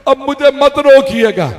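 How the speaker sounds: put-on a boy begging his uncle to let him go; young Qasim pleading. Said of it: "कहा".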